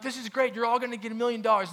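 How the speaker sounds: high, fast, giddy sales pitch voice; hyped-up promoter. Retold or imitated. Conference host thrilling the broke crowd with promises, high and fast. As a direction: sell it like a gameshow host promising riches. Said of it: "they're like".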